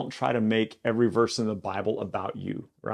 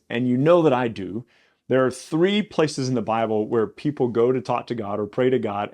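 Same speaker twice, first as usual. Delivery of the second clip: ordinary talk, nothing marked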